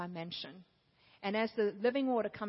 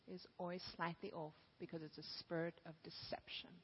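whispered God voice; 3 semitones lower, 14 dB quieter than usual. Acted. God's voice whispered.

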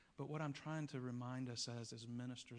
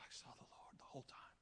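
awed whisper; 11 dB quieter than usual. Retold or imitated. Awed recounting of vision.

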